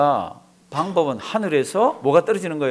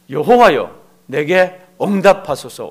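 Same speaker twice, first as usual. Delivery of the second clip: louder, slow solemn plea; Elijah's prayer slowly; 7 dB louder, slower than usual. Retold or imitated. Elijah on Carmel praying, slow loud solemn invocation. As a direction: call the name slowly and loudly. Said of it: "짧아요".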